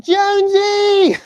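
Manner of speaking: high, drawn-out call across the room; stretched friendly holler. Retold or imitated. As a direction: sing his name out long. Calling out nickname loudly.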